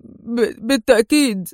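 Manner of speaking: halting, effortful agreement; hesitant stammer. A narrator's reading. Man agreeing with difficulty to the stranger, hesitant stammer. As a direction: stammer on the first sound, then get the word out. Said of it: "في صعوبة متمتما".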